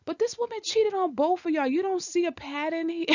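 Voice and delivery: high-pitched, incredulous challenge; exasperated disbelief. Real, not acted. Vlogger challenges him over the cheating pattern, exasperated. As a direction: pitch it way up, as if it were obvious.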